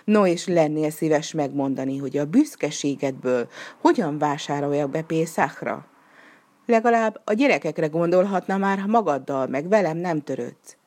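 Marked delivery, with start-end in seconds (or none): none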